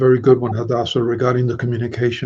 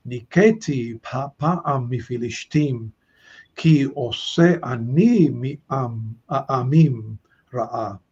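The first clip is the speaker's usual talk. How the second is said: normal talking voice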